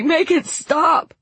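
desperate sobbing. A narrator's reading. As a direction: cry it out, pleading harder. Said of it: "Franklin sobbed".

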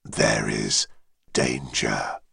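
very low, distant dragon voice; deep dragon voice. Acted. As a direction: speak as an ancient dragon, far below. Dragon head warns.

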